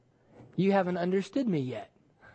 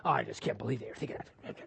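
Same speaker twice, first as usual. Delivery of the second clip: mutter; 10 semitones lower, 10 dB quieter, faster than usual. Retold or imitated. Private muttering of discontent.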